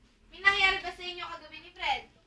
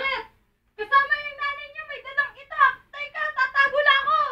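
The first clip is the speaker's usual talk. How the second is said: higher, louder panic; alarmed rush to hide; panicked; 9 semitones higher, 10 dB louder than usual. Acted. Panics seeing boyfriend's mother with machete. (takot at nagmamadali)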